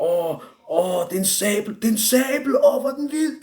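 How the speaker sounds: high, escalating awestruck squeal; mock amazement. Retold man marvelling at the sabre.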